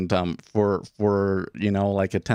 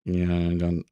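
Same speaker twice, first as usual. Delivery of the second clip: normal talking voice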